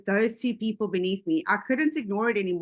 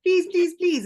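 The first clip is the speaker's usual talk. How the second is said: mock pleading, higher and louder; a friend begging her to watch a video, pleading; 8 semitones higher, 5 dB louder than usual. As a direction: imitate a friend who keeps begging. Retelling George begging her.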